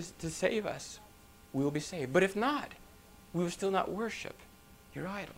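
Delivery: hushed, respectful refusal to a ruler; quiet deference with gentle pauses; submissive reply. Retold or imitated. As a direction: soften right down, humble but resolved. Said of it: "they spoke it with submission".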